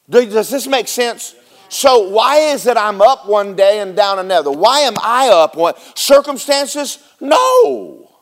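normal talking voice

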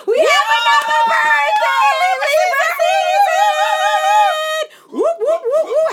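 high, loud cheering, slow and drawn out; excited birthday shout. Real, not acted. Hyping up the Libra birthday, loud excited cheering. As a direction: belt it like a party hype call.

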